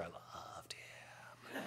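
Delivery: breathless, dreamy whisper; swooning whisper. Retold or imitated. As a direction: sigh it out like a smitten fan. Fan gushing over Alistair, quoted.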